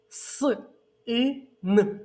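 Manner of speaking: С, и, н each said apart with pauses; separate sounds. Shown as a demonstration. Teacher names sounds for children to build a word. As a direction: sound out each letter slowly. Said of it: "Я буду називати звуки".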